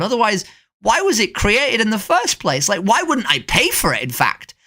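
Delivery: high, hammed-up mock outrage; mocking sarcasm. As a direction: sneer out their logic in a high voice. Sarcastic mockery of opponent's logic.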